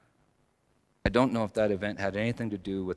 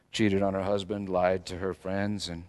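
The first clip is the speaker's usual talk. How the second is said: ordinary talk, nothing marked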